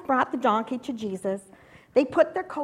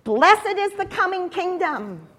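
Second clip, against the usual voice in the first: higher, raised call-and-response line; rallying proclamation; 6 semitones higher, 5 dB louder than usual. Acted. Leader giving children a line to echo, rallying. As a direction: hail it so others echo.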